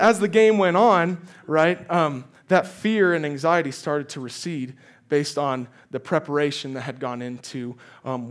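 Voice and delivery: usual speaking voice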